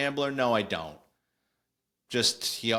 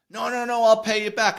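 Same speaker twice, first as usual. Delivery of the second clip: much higher, louder protesting Rob; Rob insisting; 12 semitones higher, 8 dB louder than usual. Retold or imitated. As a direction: insist hard, he'll pay it back.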